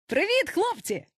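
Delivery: high, bright-pitched greeting call; hailing call. A narrator's reading. Peter hails his boys on returning, high call. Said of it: "гукнув він".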